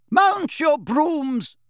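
slow, high, drawn-out command; umpire's call. A narrator's reading. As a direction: call it out across the pitch. Umpire starts the match.

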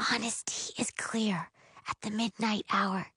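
soft whispered incantation; hushed, breath-only spell casting. A narrator's reading. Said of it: "and whispers".

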